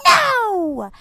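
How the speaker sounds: high, loud mock toddler yell; toddler screaming no. Mom mimics her toddler's tantrum screaming. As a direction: shriek it like a furious one-year-old.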